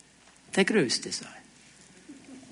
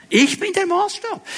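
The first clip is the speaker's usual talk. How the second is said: high-pitched egoist's claim; mock self-importance; 9 semitones higher than usual. Voices the self-centred attitude. (gespielt selbstherrlich, hohe Stimme)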